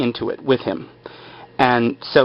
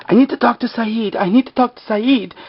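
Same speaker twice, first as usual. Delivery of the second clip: higher-pitched, pressing mock urgency; urgent retold plea; 10 semitones higher than usual. Danielle running up, insisting on seeing Sayid, urgent. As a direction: act out her breathless demand to find him.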